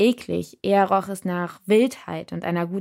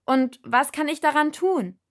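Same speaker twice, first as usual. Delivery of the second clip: higher, louder yes; shouted; 7 semitones higher, 3 dB louder than usual. A narrator's reading. Shouting agreement over the noise, loud. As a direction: yell it back.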